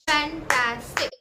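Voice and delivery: "Fantastic" slowly as फैं, टा, stick; three separate, slow syllables in fantastic. Shown as a demonstration. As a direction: break fantastic into three clapped beats. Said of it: "मैंने कितने बार clap किया".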